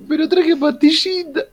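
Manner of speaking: put-on high, giggly child's plea; laughing child voice; little kid voice. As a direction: squeak it like a small boy caught out. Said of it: "me imagino a Forti chiquito".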